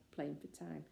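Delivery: ordinary delivery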